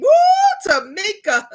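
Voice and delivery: sudden high loud whoop; excited whoop. Real, not acted. Reacting to a viewer's request, excited whoop. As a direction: yelp with delight at the name.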